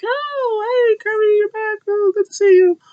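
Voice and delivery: very high falsetto mock welcome; gushing greeting. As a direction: squeal a fake-sweet hello way up high. Acting out Bayley greeting returning friend.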